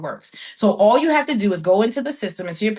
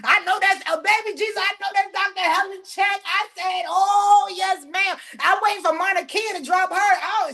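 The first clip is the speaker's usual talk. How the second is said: high-pitched delighted exclaiming; elated squeal of approval; thrilled amazement; 9 semitones higher than usual. Real, not acted. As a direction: cry it out in joyful disbelief. Reacts to doctor's big check.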